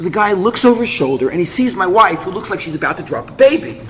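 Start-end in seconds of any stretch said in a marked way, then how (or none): none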